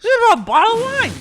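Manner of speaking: high, fast whiny offer; drunk Miranda. Retold or imitated. Miranda offering a bottle again, high whiny voice.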